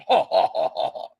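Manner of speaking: scornful fake "ha, ha, ha" that fades out; mocking laugh. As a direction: laugh in mockery at the boast. Mocking the boast about the killings.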